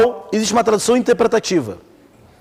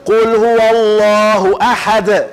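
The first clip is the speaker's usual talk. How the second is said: slow, measured Quranic chant; sheikh's recitation; much slower than usual. Shown as a demonstration. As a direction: chant like a sheikh, holding the long vowels. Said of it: "Você escuta o Sheikh lendo".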